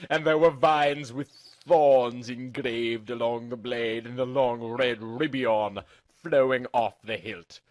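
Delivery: usual speaking voice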